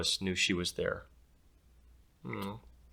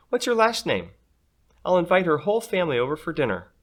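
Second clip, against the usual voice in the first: eager mom; 8 semitones higher, 6 dB louder, faster than usual. Acted. Eager mom plans dinner.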